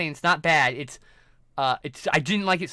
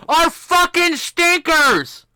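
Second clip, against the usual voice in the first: real high, loud outburst of disgust; exasperated shout; 10 semitones higher, 9 dB louder, much slower than usual. Real, not acted. Film fan ranting about an actor's bad movies, exasperated shout. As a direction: burst into a high, drawn-out shout.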